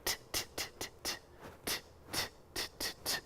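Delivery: rapid bare "T" taps without voice; T sound. Pretend. Puppet taking his turn, voiceless "T" taps. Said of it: "Your turn, Sammy".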